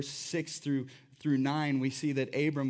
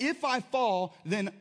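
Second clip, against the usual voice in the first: ordinary talk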